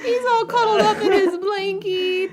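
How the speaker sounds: high, giggly cooing over someone cute; cooing while laughing. Real, not acted. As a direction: coo at him through laughter. Cooing over friend in blanket.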